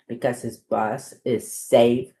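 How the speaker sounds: ordinary delivery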